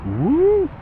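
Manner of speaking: high nervous whoop at drop; excited whoop. Real, not acted. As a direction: yelp with thrill. At the edge of drop.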